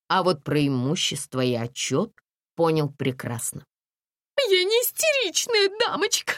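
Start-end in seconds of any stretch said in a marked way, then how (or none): 4.4-6.3 high, tearful hysterical woman; acted weepy protest, pitched way up; bursting into tears. A narrator's reading. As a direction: wail defensively in a very high voice. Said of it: "расплакалась Настя".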